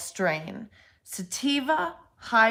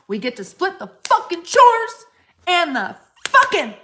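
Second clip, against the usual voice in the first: high, loud enthusiasm; excited outburst; 7 semitones higher, 7 dB louder than usual. Real, not acted. Pitching polygamy for the shared chores, loud excited outburst. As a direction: shout out the perks, pitch leaping.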